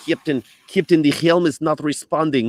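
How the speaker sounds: high, loud mock Russian accent; hammed-up Russian accent, urgent report; Chekhov. Crewman alerting the captain, urgent in a Russian accent. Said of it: "the accent that, that Chekhov had in Star Trek".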